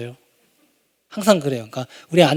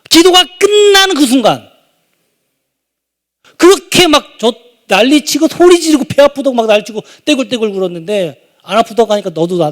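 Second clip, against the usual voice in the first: ordinary delivery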